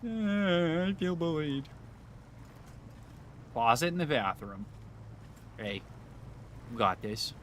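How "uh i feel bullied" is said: whiny high bullied voice; mock hurt. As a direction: pretend wounded whine.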